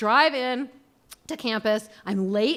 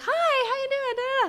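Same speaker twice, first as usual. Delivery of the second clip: high, chirpy friendly hello; perky greeting. Retold or imitated. What she'd usually say to a stranger, perky greeting. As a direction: sound overly sweet and perky. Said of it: "instead of saying".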